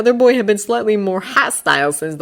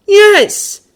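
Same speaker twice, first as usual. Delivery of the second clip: put-on high, loud haughty boy voice; Draco voice; 10 dB louder than usual. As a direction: promise it with a sneer. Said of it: "Draco returned".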